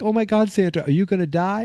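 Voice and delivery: put-on high, alarmed child's question; mock alarm. Kids seeing Santa wheeled in hurt, mock alarm. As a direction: imitate horrified kids, voice pitched well up.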